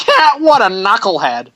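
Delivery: high, loud victory taunt; hammed-up bad voice acting; imitated game taunt. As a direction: crow it like a cheesy game fighter. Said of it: "he says it just like that too".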